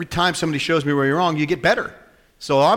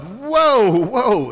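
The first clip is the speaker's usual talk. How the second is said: startled whoa; 9 semitones higher, 6 dB louder than usual. Retold or imitated. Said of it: "I'm like".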